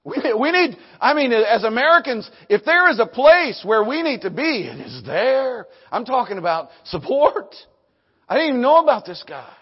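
loud, high, urgent preaching; full-force preaching. Real, not acted. Preacher urging Americans to be there, full-force preaching.

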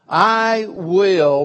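"I will" loud and firm, a mind made up; emphatic. Retold or imitated. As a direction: declare it, no doubt left. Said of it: "He's got his mind made up".

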